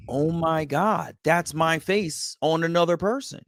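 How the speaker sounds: high-pitched retold astonishment, stretched at first; awestruck amazement. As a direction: marvel at a face just like yours. Retells his astonishment seeing birth mother.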